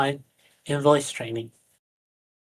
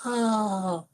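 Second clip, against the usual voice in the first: small vocal space; 7 semitones higher, 4 dB quieter than usual. Shown as a demonstration. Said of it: "with more quotient exercises".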